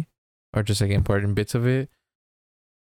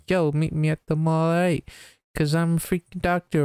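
higher, hammed-up Doc Brown bravado; mock cocky Doc; 7 semitones higher than usual. Retelling the movie, Doc brags in a higher mock voice. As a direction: play a goofy cool doctor, pitched up.

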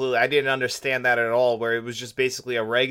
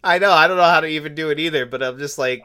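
ordinary delivery

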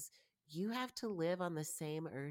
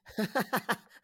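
laugh; 7 semitones higher, 9 dB louder than usual. Real, not acted. Laughing at own joke.

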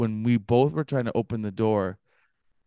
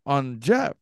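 normal talking voice

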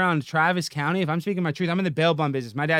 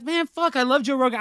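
gushing fan; 8 semitones higher than usual. Retold or imitated. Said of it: "I was kinda like".

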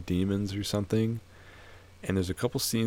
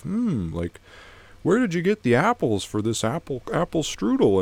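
put-on pitched-up voice of an old man at breakfast; the old man wonders aloud, voice raised high; old man asking; 7 semitones higher, 3 dB louder than usual. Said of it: "he's like to his wife".

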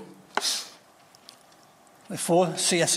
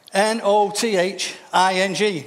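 N O T H I N G spelled slowly letter by letter; slower than usual. Shown as a demonstration. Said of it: "spelling out for emphasis".

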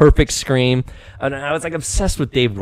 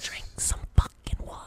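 whispering; 11 dB quieter than usual. Acted. Advice whispered, unvoiced.

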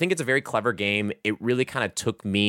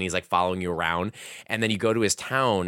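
normal talking voice

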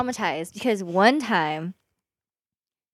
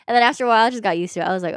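ordinary talk, nothing marked

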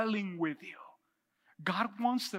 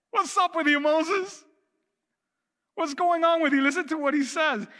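loud, high-pitched preaching; forceful preaching; 8 semitones higher, 6 dB louder than usual. Real, not acted. Pastor challenges Moses for still arguing, forceful preaching. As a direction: drive it at the congregation with raised voice.

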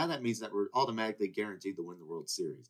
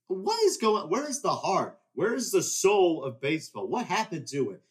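louder, higher exasperated outburst; furious rant; 7 semitones higher, 6 dB louder than usual. Real, not acted. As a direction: demand answers in frustration. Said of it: "I was furious".

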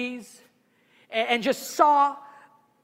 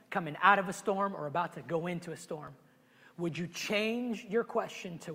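usual speaking voice

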